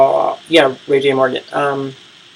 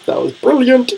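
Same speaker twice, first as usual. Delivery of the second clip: delighted praise; 10 semitones higher, 3 dB louder than usual. Real, not acted. Delighted reaction to Masika.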